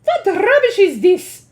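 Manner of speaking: high, loud scornful witch shout; angry shout. Acted. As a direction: scoff and shout. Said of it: "shouted the Grand High Witch".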